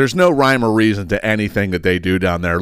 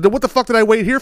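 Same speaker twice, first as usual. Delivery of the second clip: much higher, fed-up question; exasperated; 11 semitones higher than usual. Retold or imitated. Venting at the clerk after an hour's wait, high and fed up. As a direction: vent at the clerk. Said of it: "I said".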